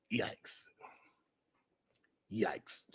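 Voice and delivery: breathy whispered yikes; soft, unvoiced yikes. Real, not acted. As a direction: barely voice it, appalled. Appalled reaction.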